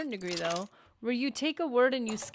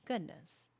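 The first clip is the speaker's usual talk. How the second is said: usual speaking voice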